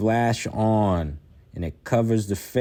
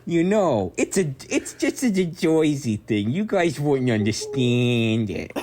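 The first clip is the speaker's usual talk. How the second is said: put-on high, brash Jersey accent; 4 semitones higher than usual. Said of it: "this Jersey accent".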